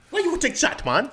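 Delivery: very high, squeaky attempt at a Jamaican accent; badly faked Jamaican lilt. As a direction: try a Jamaican accent and miss. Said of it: "That's your Jamaican accent?".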